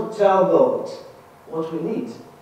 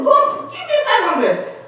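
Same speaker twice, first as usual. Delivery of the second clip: high-pitched imitation of shouting; mock shouted plea; 13 semitones higher than usual. Man praying for five hundred dollars, mock shouting. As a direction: act out a noisy, begging prayer. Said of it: "He was shouting".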